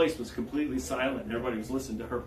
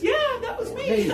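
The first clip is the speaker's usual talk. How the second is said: laughing admission; 4 dB louder than usual. Retold or imitated. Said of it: "she says".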